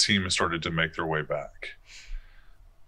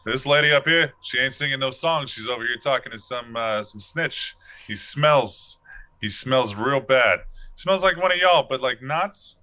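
gangster bird voice; 4 semitones higher than usual. Acted. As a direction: play the bird like an old-time gangster. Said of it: "All the birds sound like gangster from the nineteen twenties".

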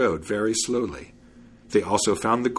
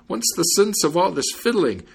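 high, pleading complaint; exasperated storybook plea; exclaimed protest; 7 semitones higher than usual. A narrator's reading. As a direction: beg the fiddler to stop, pitched up.

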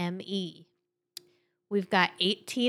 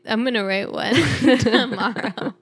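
louder, breaking into laughs mid-sentence; laughing; 3 semitones higher, 5 dB louder than usual. Real, not acted. Co-host joking about what they will write, laughing. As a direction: talk through a burst of laughter. Cracking up over own joke.

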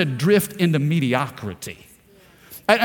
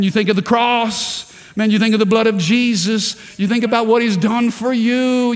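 ordinary delivery

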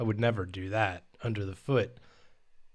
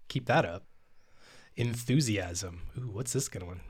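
normal talking voice

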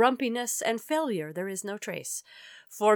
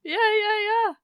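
excited yeah; 11 semitones higher, 5 dB louder than usual. Real, not acted. Excited realisation of joke.

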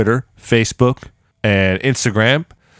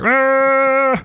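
mock Croatian sound; 13 semitones higher than usual. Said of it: "Croatians definitely don't sound like".

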